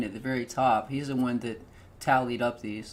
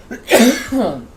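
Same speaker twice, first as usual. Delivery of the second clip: choking mid-word, loud and high; 9 semitones higher, 14 dB louder than usual. Real, not acted. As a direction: force the word out through choking. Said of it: "it's called choking coughing".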